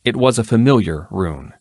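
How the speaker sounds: usual speaking voice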